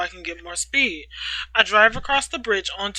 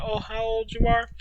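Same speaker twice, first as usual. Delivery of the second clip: ordinary delivery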